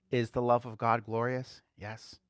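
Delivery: usual speaking voice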